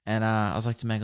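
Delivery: ordinary talk, nothing marked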